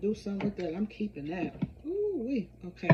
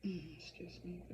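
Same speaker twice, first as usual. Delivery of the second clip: whispered excuse me; 11 dB quieter, slower than usual. Real, not acted. After clearing her throat.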